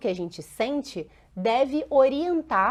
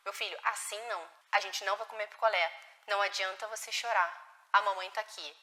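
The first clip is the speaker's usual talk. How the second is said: much softer, firm but gentle; calm guiding mother; 3 semitones lower, 8 dB quieter than usual. Shown as a demonstration. Mother guiding her child away from the popsicle, softly and firmly. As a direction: set the limit softly and kindly.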